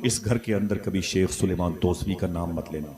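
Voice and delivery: ordinary talk, nothing marked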